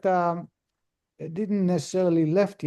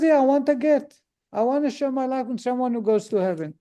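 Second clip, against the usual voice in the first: insistent wife; 7 semitones higher, faster than usual. Retold or imitated. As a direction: hammer it home like a fed-up wife. Wife repeats divorce demand.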